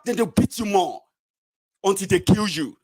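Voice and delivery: normal talking voice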